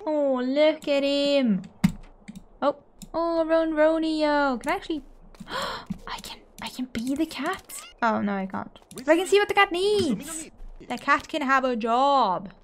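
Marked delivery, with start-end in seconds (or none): 4.6-7.8 whispered wondering; hushed. Real, not acted. Player wonders if she can control the cat, whispered. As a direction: breathe the question under your breath.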